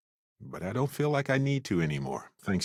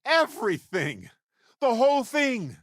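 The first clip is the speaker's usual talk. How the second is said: high, thrilled shout of victory; triumphant whoop; overjoyed cheer. Acted. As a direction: burst out with joy at the win. Celebrating a big win.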